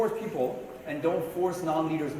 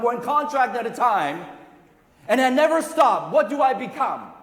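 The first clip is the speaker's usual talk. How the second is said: loud, rising rally cry to a crowd; booming motivational speech; 8 semitones higher, 9 dB louder than usual. Real, not acted. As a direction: build it up, booming, to the peak. Motivational build to climax.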